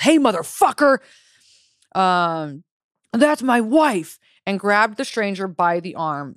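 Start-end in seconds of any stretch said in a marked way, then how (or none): 0.0-1.0 high, loud narrated husband's yell; angry yell. A narrator's reading. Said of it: "He yelled". 3.1-4.2 high, drawn-out husband's shout; angry yell. A narrator's reading. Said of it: "He yelled".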